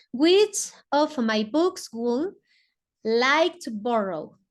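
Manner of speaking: very slow, careful English; English model sentence. Shown as a demonstration. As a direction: stretch each word for the class. (lectura lenta del inglés para la clase)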